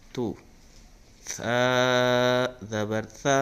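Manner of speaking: loud, slow, drawn-out chanted syllables; Qaida recitation drill. Shown as a demonstration. Teacher drilling students in hamza vowels, loud chant. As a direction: call each syllable out for pupils to repeat.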